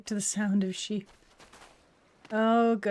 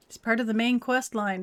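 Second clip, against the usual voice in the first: normal talking voice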